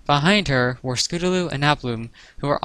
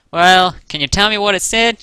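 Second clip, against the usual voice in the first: put-on louder, higher country-accent question; Applejack's country accent; 7 semitones higher, 7 dB louder than usual. A narrator's reading. Applejack asks about the letter, country accent. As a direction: ask nosily in a country twang. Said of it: "in her unmistakably country accent".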